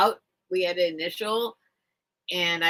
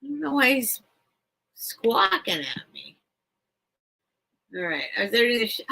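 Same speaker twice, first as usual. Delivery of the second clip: ordinary talk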